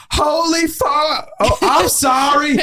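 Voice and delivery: very high, slow, tearful gasp of shock; stunned, half crying, then apologize; shocked outburst. Retold or imitated. Waitress sees huge tip.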